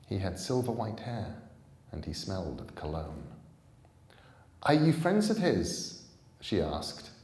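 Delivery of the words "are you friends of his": put-on higher, louder young girl; girl questioning strangers; wary girl. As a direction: ask cautiously as the girl. Girl asking.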